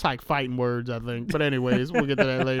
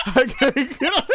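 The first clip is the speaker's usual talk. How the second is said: strained high voice; 9 semitones higher, 4 dB louder than usual. Retold or imitated. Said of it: "She was like".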